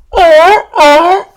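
high, loud barking seal call; imitating a seal's loud barking call. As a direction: bark it out like a seal. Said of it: "he would do the seal call".

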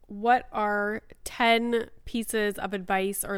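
usual speaking voice

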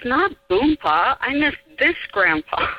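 lightly voiced little boy correcting the grown-ups; a hint of a stubborn small boy; small boy insisting. Child nephew voiced in story.

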